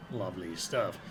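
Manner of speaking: ordinary talk, nothing marked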